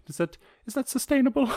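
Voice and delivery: high doubtful questioning voice; skeptical voice. Pretend. Voicing a skeptical listener.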